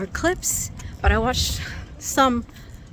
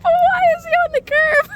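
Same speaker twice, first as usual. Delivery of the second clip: high, loud squeal through laughter; laughing, high-pitched incredulous question; laughing disbelief; 11 dB louder than usual. Real, not acted. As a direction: shriek it, cracking up. Teasing friend about the parking, laughing.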